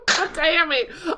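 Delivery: mimicking the clip. Imitating soundboard.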